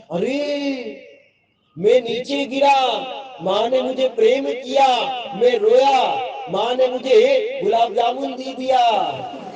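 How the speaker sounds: put-on slow excited little-boy voice; scheming child. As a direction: voice the toddler working it out. Child's thought acted out.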